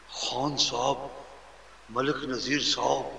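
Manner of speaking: slow, fawning respectful address; butter up the rich man; obsequious honorifics, drawn out; flattering. Retold or imitated. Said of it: "پھر ایسے بولتے ہیں جی۔".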